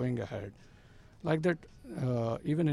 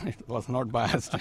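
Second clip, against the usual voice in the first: real laugh through the words; amused denial breaking into laughter; laughing protest; slower than usual. Real, not acted. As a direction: deny it while laughing, drawn out. Laughingly rejects teasing about bias.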